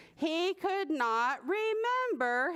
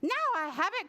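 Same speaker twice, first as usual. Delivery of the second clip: higher-pitched eager exclamation; little bird's eager cry; 7 semitones higher, much faster than usual. A narrator's reading. Little bird sure he has found his song, crying out. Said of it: "cried the little bird".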